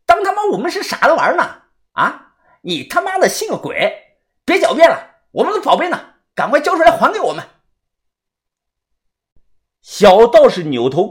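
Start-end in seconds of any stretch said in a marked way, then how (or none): 0.1-7.5 high, heated accusation; outraged high-pitched scolding; angry cursing. A narrator's reading. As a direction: berate him in a high, hurried voice. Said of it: "豆芽仔大骂".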